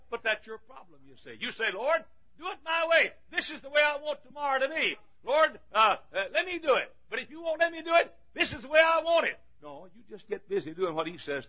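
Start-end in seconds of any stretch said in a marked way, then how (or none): 1.7-9.4 high-pitched, insistent mimicry; hammed-up wheedling demand; mock demanding prayer. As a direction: plead bossily, as if instructing God. Mimics people dictating to God.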